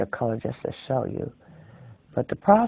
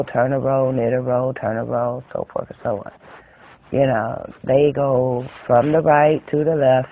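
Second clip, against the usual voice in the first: usual speaking voice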